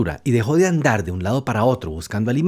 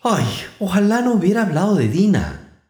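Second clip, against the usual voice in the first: a touch of wistful regret, higher; melancholy; 5 semitones higher than usual. Acted. Alice alone regrets mentioning Dina, mild wistful sigh. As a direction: sigh it to herself. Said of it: "se dijo en tono melancólico".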